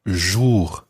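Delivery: Jour, French vowel. Shown as a demonstration. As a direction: model Jour watching the vowel. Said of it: "Watch the vowel sound that you make there".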